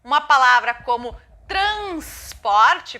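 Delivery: slow, transporte spelled out syllable by syllable; careful example word. Shown as a demonstration. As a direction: draw out transporte for the class. (pronúncia lenta e marcada de transporte)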